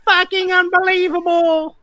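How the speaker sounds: high, broad Bogan Australian voice; over-the-top Bogan Australian showing off the slang; Bogan accent. Shown as a demonstration. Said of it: "how to do a Bogan accent".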